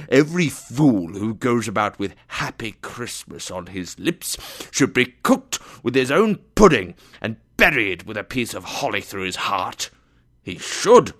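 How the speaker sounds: loud, indignant tirade, punching key words; angry rant. A narrator's reading. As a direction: build to a loud, emphatic finish. Scrooge rants about fools.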